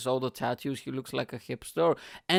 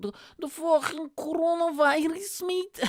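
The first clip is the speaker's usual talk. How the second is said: very high, strained drunk mimicry; mock a drunk conspiracy ranter, pitched way up; drunk guy impression; 3 dB louder than usual. Stranger answering what he is talking about, high drunk voice.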